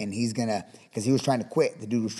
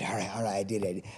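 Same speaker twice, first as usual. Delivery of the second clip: much softer, lower, relieved; quiet relief; 4 semitones lower, 9 dB quieter than usual. Retold or imitated. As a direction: breathe out that you finally did it. Kid relieved after success.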